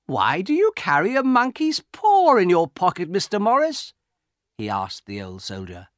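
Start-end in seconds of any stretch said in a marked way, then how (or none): 0.1-3.9 put-on high, loud, incredulous young man's question; amused disbelief. A narrator's reading. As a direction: tease the old soldier, pitched way up. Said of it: "and he laughed".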